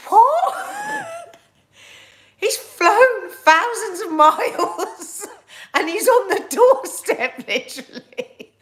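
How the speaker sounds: high, loud, laughing disbelief; retold shocked outburst. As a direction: squawk it in mock outrage, laughing. Acting out her shocked reaction to sister.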